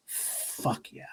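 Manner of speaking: breathless whisper of awe; hushed fan whisper. Retold or imitated.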